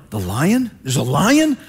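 rising, baffled "lion?" questions; half-comic disbelief, last word shot up high; bewildered surprise. Retold or imitated. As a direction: play John stunned that a lamb walks in. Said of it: "John's probably going like".

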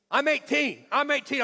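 high, loud, indignant protest; defiant teenager. Retold or imitated. Son arguing with dad over his outfit, defiant. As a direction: imitate a teen insisting he is grown. Acting son's defiant outburst.

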